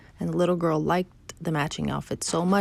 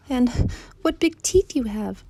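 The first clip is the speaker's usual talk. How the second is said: put-on high, slow, timid child; small voice; 7 semitones higher, 4 dB louder, slower than usual. Trembling girl notices the wolf's teeth, high small voice. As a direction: falter slowly, pitched high. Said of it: "she said in a small voice".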